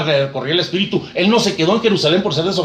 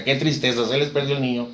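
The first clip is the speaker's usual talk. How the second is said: sorrowful hush; 3 semitones lower, 6 dB quieter than usual. Acted. Sorrowful aside over lost child.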